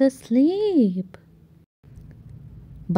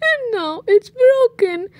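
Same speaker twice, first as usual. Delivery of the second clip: high tearful little bear; Baby Bear finding his broken chair, high teary voice; Baby Bear crying; 10 semitones higher, faster than usual. Acted. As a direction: sob over the broken chair.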